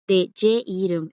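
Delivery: ordinary talk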